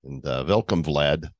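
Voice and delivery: low-pitched mock Russian accent. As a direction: put on a low Russian voice, as Yuri. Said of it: "So we do as Russian today".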